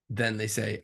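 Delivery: usual speaking voice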